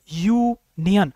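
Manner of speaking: यूनियन said very slowly, starting on a clear y sound; slow demo word. Shown as a demonstration. Teacher slowly sounding out a word. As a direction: stretch out यूनियन so the y is heard. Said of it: "जब हम यूनियन बोलते हैं".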